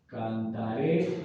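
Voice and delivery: "Cantaré" drawn out slowly, rising onto the final é; stress on final E. Shown as a demonstration. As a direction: stretch "Cantaré" and lean on its last é. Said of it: "Ese acento en esa E". ("Cantaré" lento, con acento en la é final)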